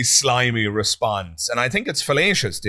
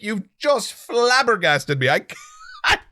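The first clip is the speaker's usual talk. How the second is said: flabbergasted disbelief; 12 semitones higher than usual. Real, not acted. Host stunned that the apologist admits believing in magic, pitched up in amazed disbelief. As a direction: let the disbelief lift the voice up high.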